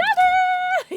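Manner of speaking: high, loud burst of laughter. Real, not acted. Laughs at the teammate.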